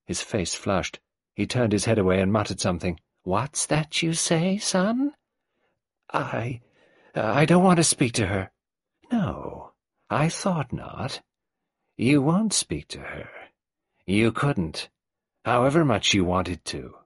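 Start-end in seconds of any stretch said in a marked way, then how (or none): 3.2-5.1 put-on higher, creaky old matriarch voice; old tyrant mother. Old Mrs Boynton greeting Nadine, in her higher old-woman voice. 6.1-8.5 put-on a touch of strained young man; nervous son. Raymond refusing his mother, lightly voiced and strained. 9.1-17.0 put-on higher, creaky old matriarch voice; old tyrant mother. Old Mrs Boynton greeting Nadine, in her higher old-woman voice.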